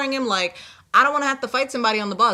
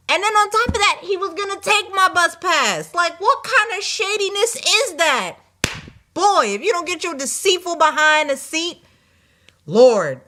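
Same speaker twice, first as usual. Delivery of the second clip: indignant rant; 8 semitones higher, 4 dB louder than usual. Real, not acted. Indignant rant about the shady man.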